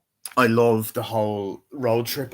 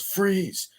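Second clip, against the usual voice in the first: high-pitched pretend "freeze" order; raised-pitch retold challenge; mock command; 10 semitones higher than usual. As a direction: imitate bursting in on a suspect. Acting out officer bursting in.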